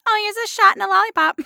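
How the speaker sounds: very high, quick mock doctor voice; hammed-up kiddie-doctor voice; chirpy doctor. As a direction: rattle it off high, as if treating a little kid. Doctor handing out lollipop, voiced.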